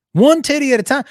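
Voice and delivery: high, loud hammed-up doctor; mock doctor. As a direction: sell it like a cheesy surgeon.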